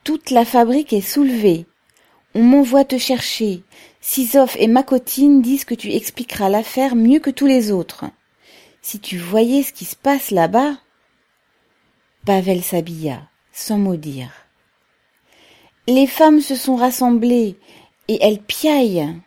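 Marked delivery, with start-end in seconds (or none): 0.0-10.8 higher and louder, words rushing out breathless; breathless excitement. A narrator's reading. Fédia bursting in to fetch Pavel, breathless and excited. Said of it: "d'une voix essoufflée". 15.9-19.2 higher, louder agitated news; excited report. A narrator's reading. Fédia describing the women at the factory, agitated.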